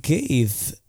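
halting delivery with odd pauses; swooping, stop-start cadence; Christopher Walken. Acted. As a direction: speak in broken phrases, each peaking then dropping. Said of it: "let's hear Christopher Walken first".

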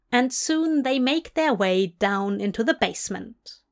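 plain reading voice

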